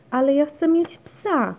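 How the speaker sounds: high, loud protest; child's insistent cry. A narrator's reading. Basia demands a dog from mum, crying out. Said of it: "krzyknęła".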